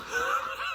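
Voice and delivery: quick airy laugh at his own joke; a little laugh that slips out; short breathy chuckle. Real, not acted.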